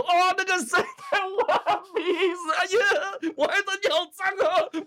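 high, loud, slow sing-song taunt with laughter; mocking taunt. Kid loudly mocking a classmate for picking her nose. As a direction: tease a classmate in a shrill mock voice.

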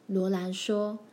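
plain reading voice

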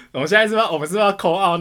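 laughing as you ask. Real, not acted. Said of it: "我完全不知道该怎么回应". (笑着说)

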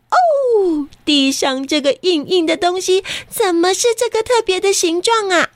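high delighted discovery; excited. Acted. Mother voiced in story.

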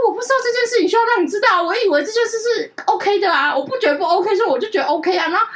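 high-pitched retold self-defense; indignant protest. Retelling own protest to mother. (高声辩解)

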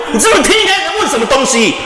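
high shout through a laugh; angry shout. Retold or imitated. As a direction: yell him down in disbelief. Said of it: "我就真的发飙".

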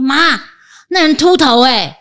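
imitating a child blurting out loud; high, loud mock call to Mom. Child loudly telling Mom about a bald man in elevator, loud blurting. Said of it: "他就很大声说".

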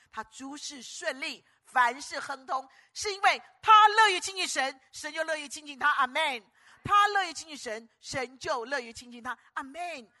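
slow then high, loud preacher's proclamation; preacher crying out 乐于亲近神; climactic preaching. Real, not acted. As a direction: build to a triumphant close.

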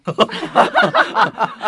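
loud high laugh; burst of laughter. Real, not acted. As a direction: break into laughter. Teacher laughs at wrong guess.

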